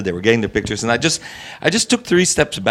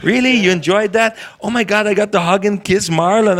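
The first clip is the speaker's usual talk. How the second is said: higher, incredulous retelling; teasing surprise, pitched up; mock amazement; 7 semitones higher than usual. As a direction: play up the disbelief. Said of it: "I said".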